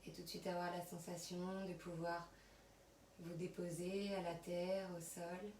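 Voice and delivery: soft, unhurried yoga nidra voice with long pauses; guided relaxation. Acted. Yoga teacher settling students on their mats, soft guided relaxation. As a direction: guide them quietly through body and breath.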